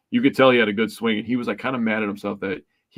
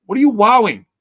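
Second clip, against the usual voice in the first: much higher, louder, outraged disbelief; hammed-up indignation at the pitcher, pitched way up; indignant retort; 11 semitones higher, 6 dB louder than usual. Fan mocking a pitcher who wowed at a homer, high, loud indignation. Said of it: "And it's like".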